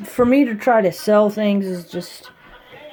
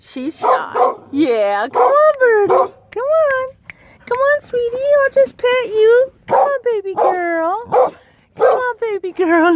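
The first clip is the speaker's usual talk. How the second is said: much higher, gentle coaxing; high coaxing pet talk; 3 dB louder than usual. Acted. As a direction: coax a shy pet to come closer. Coaxing shy bird.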